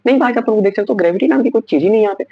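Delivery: high-pitched comic exasperation; exasperated outburst. Real, not acted. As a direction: throw up your hands at the nonsense. Mocking film goof, exasperated.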